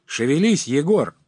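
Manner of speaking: high, loud command; rowdy urging. Acted. Revellers spur drunken Егор to dance, rowdy shout.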